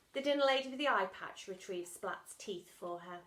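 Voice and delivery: ordinary delivery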